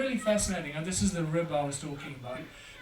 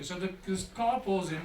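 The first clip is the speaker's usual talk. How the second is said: ordinary talk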